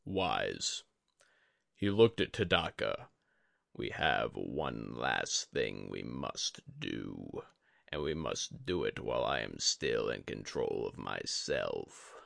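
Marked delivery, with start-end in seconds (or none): 3.7-12.1 low, slow, grave teacher's voice; a dying sensei, calm and heavy; Yori the old master. A narrator's reading. Yori speaks to Tadaka.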